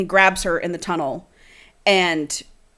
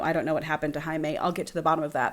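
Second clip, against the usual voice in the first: reading a romance novel aloud, a bit softer; dramatic book reading; 3 semitones lower, 8 dB quieter than usual. Acted. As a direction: read the scene like an audiobook.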